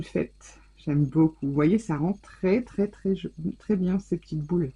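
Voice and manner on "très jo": ordinary talk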